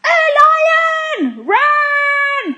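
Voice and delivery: very high, drawn-out shout; full-voiced panicked yell; yelled alarm. A narrator's reading. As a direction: scream a warning to flee. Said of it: "when he yells".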